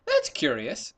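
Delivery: leopard puzzled. Acted. Leopard puzzles over unseen giraffe.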